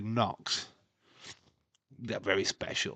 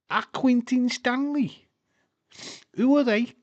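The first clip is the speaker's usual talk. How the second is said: advert quote; 14 semitones higher, 9 dB louder than usual. Retold or imitated. Re-enacting the milk advert kids.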